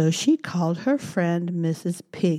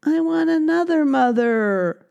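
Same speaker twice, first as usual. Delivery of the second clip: higher, whiny demand; whiny baby mouse; 9 semitones higher, 4 dB louder than usual. Acted. Baby mouse demands a new mother, high whine. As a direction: whine it like a sulky child. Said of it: "cried Baby Mouse".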